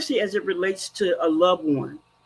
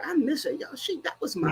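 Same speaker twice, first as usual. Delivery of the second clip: real tears cracking through, much softer; crying; 5 semitones higher, 7 dB quieter than usual. Real, not acted. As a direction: voice breaking into tears over her daughter. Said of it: "you may see me cry like I just did".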